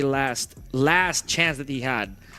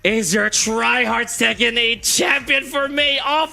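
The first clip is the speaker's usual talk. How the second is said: big, slow champion announcement; announcer shout; 10 semitones higher, 6 dB louder, slower than usual. Real, not acted. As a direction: belt out the winner's name.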